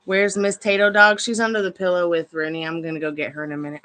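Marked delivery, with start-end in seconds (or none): none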